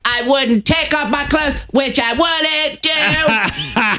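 high, slow yelling woman voice; Tan Mom impression. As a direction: yell it high and drawn out. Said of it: "she's like".

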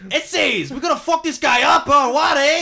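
high, shouted mock Mexican accent. Shown as a demonstration. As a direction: chase a thief in a cartoon Mexican accent. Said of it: "Do a Mexican accent".

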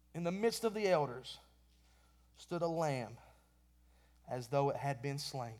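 solemn Bible reading that sinks to a hush; scripture reading. Acted. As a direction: read the verse with reverence, fading to a near hush at the slain lamb. Reads Revelation aloud.

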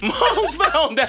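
slow, laughing through the words. Real, not acted. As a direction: crack up as you say it. Laughing retelling.

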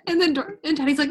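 ordinary talk, nothing marked

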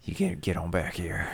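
low whispered threat, imitating a cross dad; mock-menacing whisper; pissy dad. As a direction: order the kid back under your breath. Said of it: "he's all shitty and pissy again".

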